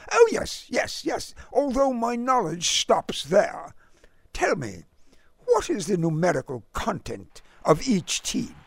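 put-on high, clipped English gent's accent; innocent, over-formal query in a British accent; lofty British accent. A narrator's reading. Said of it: "in his lofty, clipped accent".

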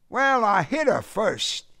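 high-pitched Southern admission; Southern accent. A narrator's reading. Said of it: "His southern accent convulsed them".

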